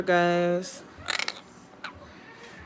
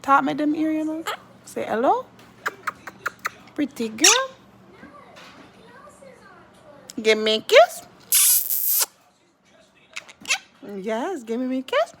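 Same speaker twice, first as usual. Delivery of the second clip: put-on high baby talk; 9 semitones higher than usual. Owner coaxing her parrot to say hello, high baby talk. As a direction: coo up high to coax the bird to speak.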